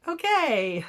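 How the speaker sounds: mock surprise at being ignored; unimpressed okay. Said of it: "I was like".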